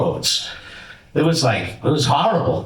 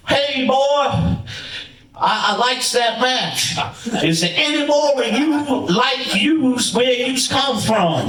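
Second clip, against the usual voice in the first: much higher put-on voice of the old promoter; high, hammed-up impression of a veteran booker; Tony Atlas impression; 8 semitones higher, slower than usual. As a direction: do your best Tony Atlas, slow on the praise. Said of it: "in my best Tony Atlas voice".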